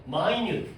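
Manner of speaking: "minute" pronounced my-NYOOT; correct adjective pronunciation. Shown as a demonstration. Teacher repeating the word for tiny to students, "minute" said my-NYOOT. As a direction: say "minute" as my-NYOOT, long i first. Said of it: "이게 어원이 프랑스어에서 나온 영어 어원이 아닌 딴 어원이에요".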